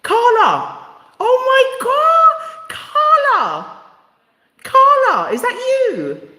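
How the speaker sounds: high, loud delighted cry of recognition; surprised shriek. Real, not acted. Host spots old friend on live, screaming with joy. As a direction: shriek her name in joyful shock.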